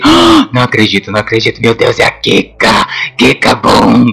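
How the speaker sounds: loud gasping shock at meeting an idol; hammed-up starstruck disbelief; starstruck fan. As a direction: gush like a stunned fan. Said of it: "eu tô aqui porque eu tô exagerando".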